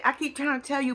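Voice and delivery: usual speaking voice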